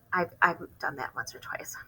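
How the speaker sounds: soft breathless whisper aside; reader's whispered aside to listeners. Real, not acted. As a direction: confide it under the breath. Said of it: "aside in whisper".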